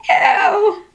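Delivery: giggling through the words; can't stop laughing. Real, not acted. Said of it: "Don't laugh".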